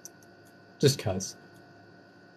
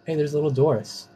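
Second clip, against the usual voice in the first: ordinary delivery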